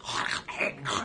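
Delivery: wordless throaty hacking as fake French; mock French noise. Mocking French to a Canadian, guttural throat noises. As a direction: hack out nonsense sounds for French. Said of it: "this is what French sounds like".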